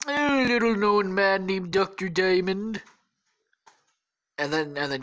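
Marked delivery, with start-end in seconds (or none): 0.1-2.8 hammed-up presidential impression; mock politician. Trump names a man, hammy impression.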